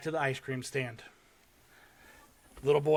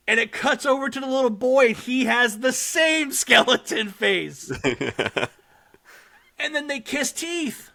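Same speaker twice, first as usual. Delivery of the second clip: much higher and louder, laughing with delight; excited gushing; 10 semitones higher, 7 dB louder than usual. Real, not acted. Host raving to co-hosts about the skeleton kiss, excited. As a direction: gush over it like it's adorable.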